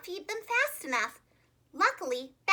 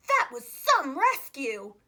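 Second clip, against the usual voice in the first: louder, slow, admiring man's voice; impressed lifeguard; 6 dB louder, slower than usual. Acted. Lifeguard praising George after the rescue, loud and admiring. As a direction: praise him, amazed.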